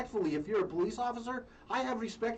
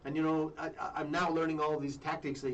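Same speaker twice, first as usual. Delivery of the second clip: ordinary delivery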